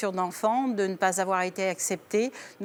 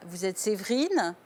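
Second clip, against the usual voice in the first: usual speaking voice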